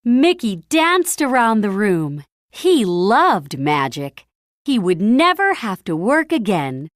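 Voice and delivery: usual speaking voice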